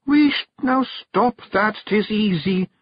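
high, slow Scots snap; snapping Kelda. Acted. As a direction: hush him sharply. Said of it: "snapped Jeanie".

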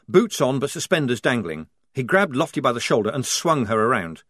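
plain reading voice

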